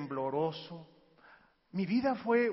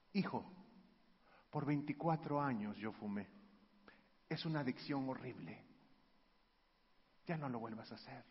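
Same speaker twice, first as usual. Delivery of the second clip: softer, tender fatherly advice; a soft, kind father speaking low; gentle father; 6 semitones lower, 10 dB quieter than usual. Retold or imitated. Father gently warns his son about smoking, soft and tender.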